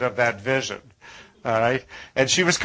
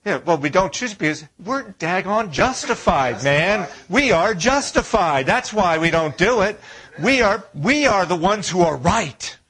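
higher, louder mock indignation; mock self-righteous; 6 semitones higher, 4 dB louder than usual. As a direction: ham up the smug justified crowd. Preacher mocks self-justification.

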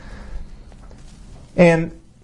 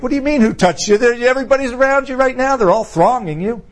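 higher-pitched, baffled protest; incredulous disciples; 7 semitones higher, faster than usual. Retold or imitated. Disciples questioning Jesus in the crowd, incredulous. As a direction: imitate puzzled followers objecting.

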